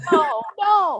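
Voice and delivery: loud, high panicked protest, half laughing; hammed-up dismayed cry; mock panic. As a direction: act out alarm at someone crying. Coach panics at crying player.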